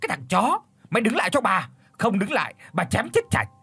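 wife chasing her man with a cleaver, screaming curses; furious screaming. Acted. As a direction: scream threats while chasing. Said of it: "vừa chạy vừa chửi".

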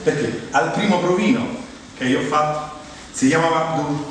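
usual speaking voice

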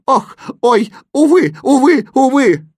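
loud, high moans of woe; wailing lament. A narrator's reading. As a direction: cry out each woe in a piercing wail. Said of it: "застонал восклицательный".

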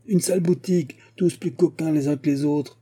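high-pitched burst of invective, easing off; violent outburst, acted. A narrator's reading. Clerk abusing the rival shop at table, mildly acted angry outburst. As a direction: start indignant and high, settle toward the end. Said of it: "il lâcha des mots violents".

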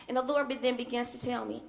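normal talking voice

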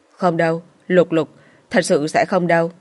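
ordinary delivery, nothing marked

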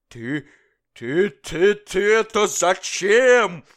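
high, loud, slow stammering fury; king turning furiously on the jester; furious question. Acted. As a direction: spit it out at the jester in rage. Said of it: "яростно поворачиваясь к шуту".

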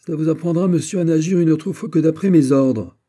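loud, high scolding; stern reprimand. Acted. General reprimands the officer who acted without orders, stern. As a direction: teach him a lesson sharply.

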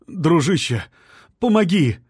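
high, loud desperate plea; a husband begging a stranger for help, pleading; pleading man. A narrator's reading. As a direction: beg for help, voice straining up. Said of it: "взмолился он".

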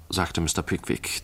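plain reading voice

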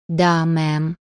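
"мэм" with the э slightly stretched; a southerner's lingering э in "мэм"; drawn-out southern vowel. A narrator's reading. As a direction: lengthen the vowel in "мэм" a little, like a faint bleat. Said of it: "слегка протягивал букву".

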